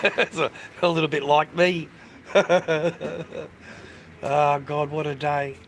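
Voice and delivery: laughing through the line at himself. Real, not acted. Joking about himself to viewers, laughing. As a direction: chuckle it out, self-mocking.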